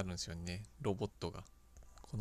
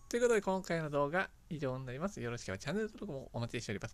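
louder, higher sign-off, final bye soaring; upbeat channel outro; 5 semitones higher, 7 dB louder than usual. Acted. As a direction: wrap up the video brightly for viewers. (元気なエンディングの挨拶)

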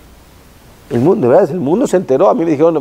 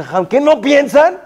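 indignant complaint; 8 semitones higher than usual. Retold or imitated. Man complaining to rabbi.

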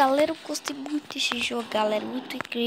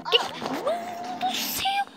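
high shocked exclamation; startled; 10 semitones higher than usual. Real, not acted. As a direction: gasp it out in fright. Scared by game.

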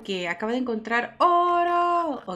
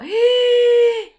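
jadeo de sorpresa; 13 semitones higher, 10 dB louder than usual. Real, not acted. Sorpresa por árbol flotando.

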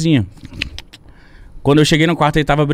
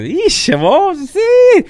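mock high exclamation; 3 dB louder, much slower than usual. Real, not acted.

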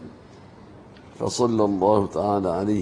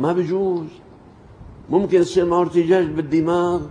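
higher, louder preaching warning; raised warning; 6 semitones higher, 4 dB louder than usual. Real, not acted. Preacher warning the congregation, louder and higher. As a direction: warn the crowd firmly, voice rising. Preacher warns against hitting.

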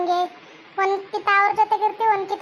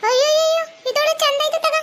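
shocked gossip; 6 semitones higher than usual. Acted. Shocked by wedding news.

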